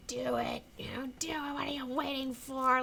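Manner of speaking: high, breaking, acted plea; tearful plea. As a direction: imitate someone crying and daring them to act. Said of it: "and he's crying".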